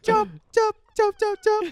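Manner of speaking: silly high voice. Pretend. Joke voice performance.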